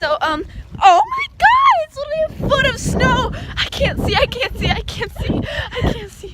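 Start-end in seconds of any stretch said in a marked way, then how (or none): 0.8-3.3 high shrieking oh my God; shocked shriek. Real, not acted. Caught in heavy snow. 3.6-6.3 high, loud panicked chant; panicked repeating. Real, not acted. Blinded by snowstorm.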